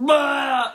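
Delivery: loud, high mock retch; big acted puking noise; imitated vomiting. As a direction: heave it out in one comic burst.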